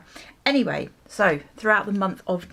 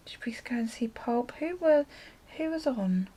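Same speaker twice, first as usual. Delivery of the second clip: a private wondering, under breath; unvoiced aside while thinking back; whispered aside; 4 semitones higher, 4 dB quieter than usual. Real, not acted. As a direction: whisper the question to yourself. Wondering to herself.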